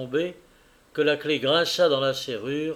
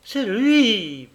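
high, loud accusing cry; frantic cry, pitched high; 8 semitones higher, 7 dB louder than usual. A narrator's reading. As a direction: shout out the blame in panic. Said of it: "cria-t-elle en se débattant".

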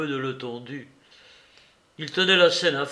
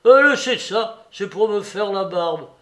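panicked protest; 6 semitones higher, 9 dB louder than usual. Acted.

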